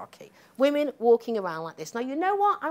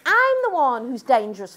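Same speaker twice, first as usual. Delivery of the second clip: sarcastic, high and loud protest; mock outrage at being blamed; mock indignant; 5 semitones higher, 5 dB louder than usual. Lecturer mocking critics, sarcastic loud protest.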